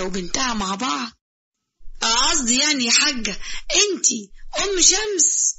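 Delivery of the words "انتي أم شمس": slow surprise. Acted. Zizo's surprised question.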